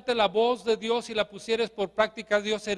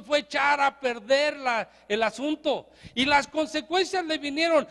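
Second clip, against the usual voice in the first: fired-up preaching; 5 semitones higher than usual. Acted. Preacher warning his congregation, preaching at full force. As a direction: drive the story home from the pulpit.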